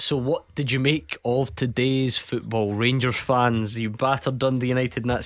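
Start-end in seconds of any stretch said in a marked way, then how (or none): none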